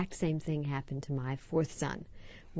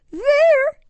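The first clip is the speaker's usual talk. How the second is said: put-on very high, loud frightened little-girl cry; scared child; 15 dB louder than usual. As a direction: point and cry out in fear. Child pointing at scary TV.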